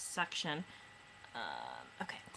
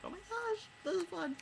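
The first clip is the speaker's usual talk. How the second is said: much higher, soft giddy squeal; giddy high-pitched excitement; thrilled squeal; 12 semitones higher, 5 dB quieter than usual. Retold or imitated. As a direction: squeal with delight at a scary tale. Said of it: "So I'm like".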